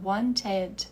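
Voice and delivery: wanted com o E final bem aberto e claro; "wanted" pronunciando bem o E antes do D; E bem pronunciado. Shown as a demonstration. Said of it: "falava o E bem pronunciado". ("wanted" com E claro, devagar)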